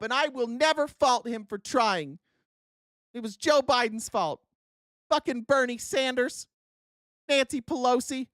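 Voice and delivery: hammed-up loyal voter shifting blame; mock excuse-making voter. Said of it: "and you'll just be like".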